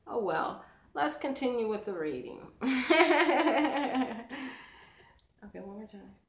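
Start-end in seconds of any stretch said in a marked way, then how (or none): 2.5-4.8 loud high rising laughter; laughing. Real, not acted. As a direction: break up laughing. Laughing at the light mishap.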